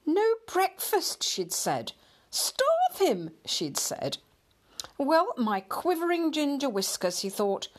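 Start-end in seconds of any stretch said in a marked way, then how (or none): 0.0-1.2 slow, high-pitched disbelief; indignant echo. A narrator's reading. Upset cat recalling the wife's threat, indignant. 2.3-3.3 very high, scandalised exclamation; indignant echo. A narrator's reading. Upset cat recalling the wife's threat, scandalised. 5.0-7.2 high-pitched huffy exclamation; indignant. A narrator's reading. Upset lighthouse cat hearing he may be starved, indignant.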